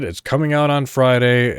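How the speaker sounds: usual speaking voice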